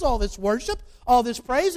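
normal talking voice

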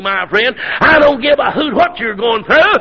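usual speaking voice

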